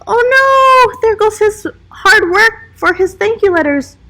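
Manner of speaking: high, loud oh no, falling into dismay; dismayed surprise. Real, not acted. Narrator reacting as George's bag slips, dismayed.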